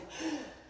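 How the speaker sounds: sharp gasp, breath suddenly lost; breath-catching gasp. Retold or imitated. Woman reliving ice water dumped over her, shocked gasp. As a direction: gasp as if the air is knocked out of you. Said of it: "like, I lose my breath".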